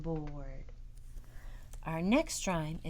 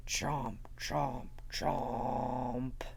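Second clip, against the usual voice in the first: chomping dinosaur; 7 semitones lower, much slower than usual. Acted. Dinosaurs eating in rhyme.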